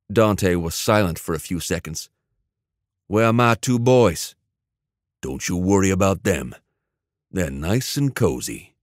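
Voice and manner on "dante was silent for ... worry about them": usual speaking voice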